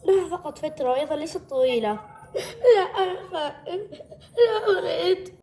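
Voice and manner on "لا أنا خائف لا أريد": high, tearful whining; whimpering protest; fearful refusal. Acted. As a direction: plead like a frightened child. Child still refuses the wheelchair.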